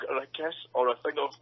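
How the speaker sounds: ordinary talk, nothing marked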